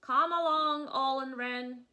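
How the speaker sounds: loud cawing raven voice; calling raven. Acted. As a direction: call everyone to gather like a crow. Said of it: "Raven says".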